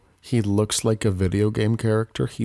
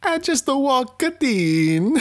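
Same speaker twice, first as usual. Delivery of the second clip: high, slow mock Italian accent; Jared Leto's Italian accent; slower than usual. Leto Italian persona.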